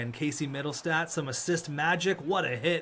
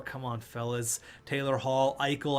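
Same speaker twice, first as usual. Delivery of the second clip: ordinary talk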